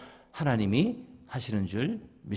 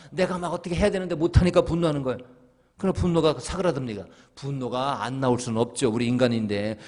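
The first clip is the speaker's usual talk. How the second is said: ordinary talk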